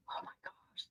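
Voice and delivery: a breathless whisper of horror; just breathe the words out, no voice. Real, not acted. Reacting to the thought of drowning, whispered.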